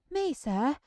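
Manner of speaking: high, loud surprised cry; excited cry. A narrator's reading. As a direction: burst out in delight. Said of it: "cried Sam".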